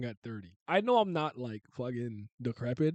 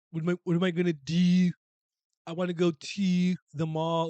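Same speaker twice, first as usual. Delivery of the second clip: put-on Baltimore accent; 4 semitones higher, 6 dB louder than usual. Streamer mimicking how Baltimore people talk, elongated accent. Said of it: "they, like, elongate".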